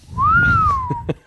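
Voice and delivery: big high burst of laughter; loud laugh. Real, not acted. Loud laugh after drinking joke.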